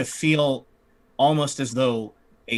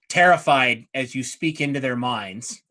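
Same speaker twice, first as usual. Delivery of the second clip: normal talking voice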